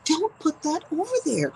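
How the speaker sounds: high-pitched mock scolding; bickering couple impression. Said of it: "They're just kinda like".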